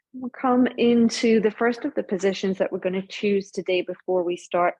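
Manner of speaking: ordinary talk, nothing marked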